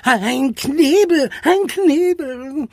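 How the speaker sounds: high, drawn-out horrified wail. A narrator's reading. Charlie hears of a gag, horrified. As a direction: stretch it out in fright. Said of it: "murmelte Charlie erschrocken".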